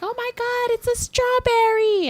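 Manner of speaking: mock girl gushing over the car; excited girl. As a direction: squeal like a delighted girl. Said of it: "girls are just like".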